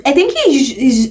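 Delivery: ordinary talk, nothing marked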